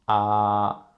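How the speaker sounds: "ah" held long on one level pitch; flat tone. Shown as a demonstration. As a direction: sustain a flat, even "ah", as for the dentist. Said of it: "very flat and even".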